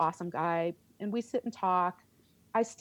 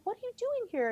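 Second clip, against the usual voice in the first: high, stunned question at the surprise; shocked disbelief; faster than usual. Retold or imitated. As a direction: gasp it out, much higher, in disbelief. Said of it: "I lost it".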